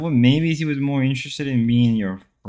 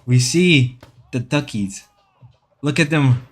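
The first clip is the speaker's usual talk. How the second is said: nature documentary. Pretend. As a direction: narrate seals like a documentary. Documentary narration continues.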